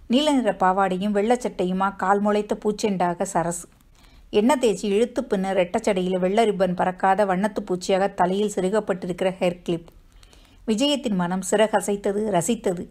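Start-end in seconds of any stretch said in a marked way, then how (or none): none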